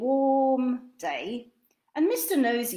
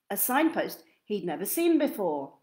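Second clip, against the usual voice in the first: ordinary delivery, nothing marked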